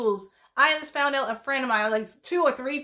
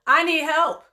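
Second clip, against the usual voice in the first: louder, higher plea; raised, imploring admission; acted plea for help; 6 semitones higher, 7 dB louder than usual. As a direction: voice someone crying out for help. Voicing someone admitting they struggle.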